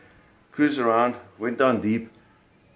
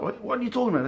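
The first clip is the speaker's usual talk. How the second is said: startled father; 13 semitones higher, faster than usual. Retold or imitated. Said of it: "he jumped up".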